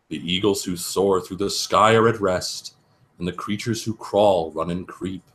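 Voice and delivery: deadpan lullaby reading; bedtime story reading. Acted. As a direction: read it like a bedtime book. Reads the picture book aloud.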